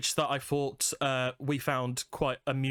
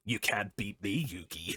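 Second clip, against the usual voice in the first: lower, fading to a soft menace; a cartoon rival, low and dropping away; mock duel taunt, quieter at the end; Yu-Gi-Oh villain; 5 semitones lower, 4 dB quieter than usual. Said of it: "I instantly thought".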